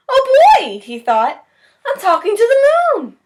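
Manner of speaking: bubbling over with joy; excited. A narrator's reading. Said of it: "Bear got very excited".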